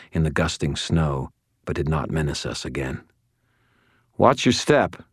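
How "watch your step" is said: warning. A narrator's reading. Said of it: "Fix warned me".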